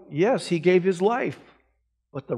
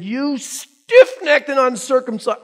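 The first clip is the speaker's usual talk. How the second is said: slow, higher, louder: "stiff-necked" thrust out as an accusation; harsh rebuke; 5 semitones higher, 5 dB louder, slower than usual. Retold or imitated. Preacher voicing Stephen denouncing the council, slow, loud and harsh. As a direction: hurl the rebuke at the crowd slowly. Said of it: "I don't know which tone of voice he used".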